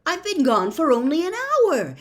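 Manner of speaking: high, loud cry of amazement; can't believe it, crying out in wonder; amazed cry. Acted. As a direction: call it out, astonished. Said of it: "he cried in amazement".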